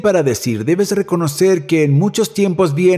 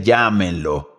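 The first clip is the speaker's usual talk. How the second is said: gentle call; 8 semitones lower, slower than usual. Retold or imitated. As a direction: say it like a gentle invitation. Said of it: "Jesús dice". (llamada suave y grave)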